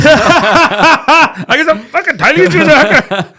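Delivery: high, loud shout through laughter; laughing I-told-you-so yell; laughing vindication. Retold or imitated. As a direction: crow it helplessly, cracking up.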